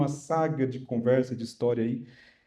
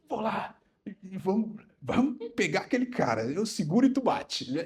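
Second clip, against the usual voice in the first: much higher, put-on voice of his wife; wife's voice; 9 semitones higher than usual. Wife insisting she rides along to confront the mechanic, high voiced. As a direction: play the eager wife insisting on coming. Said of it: "Cheia de sabedoria".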